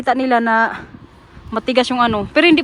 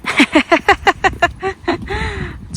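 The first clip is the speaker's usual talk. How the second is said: breathless laugh; 7 semitones higher than usual. Real, not acted. Laughing while jogging.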